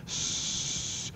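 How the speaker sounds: a long, steady s- with no voice behind it; sustained S. Shown as a demonstration. Lecturer showing students a voiceless fricative, long steady hiss. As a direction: hold the S sound, just air through the teeth. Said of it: "si uno pronuncia una S seguido".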